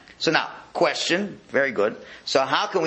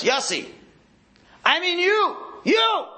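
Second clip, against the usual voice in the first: booming announcer call; slower than usual. Retold or imitated. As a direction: call out over a stadium PA. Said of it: "You hear the voice".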